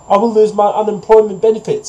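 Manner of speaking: high-pitched goose's protest; goose refusing. A narrator's reading.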